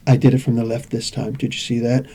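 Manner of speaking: low, hushed male-model voice; Zoolander. Retold or imitated. Zoolander showing off his look, low and soft. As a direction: drop low and soft, a self-impressed model. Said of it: "Go back and watch Zoolander".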